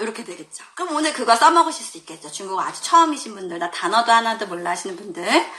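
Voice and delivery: usual speaking voice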